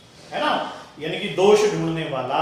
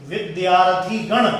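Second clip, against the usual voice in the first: ordinary talk, nothing marked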